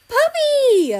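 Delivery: high, loud squeal of delight; excited yell. A narrator's reading. Kiwi spotting the dog, excited yell. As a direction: shriek with joy at the dog. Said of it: "Kiwi yelled".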